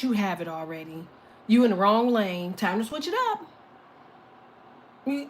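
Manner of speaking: usual speaking voice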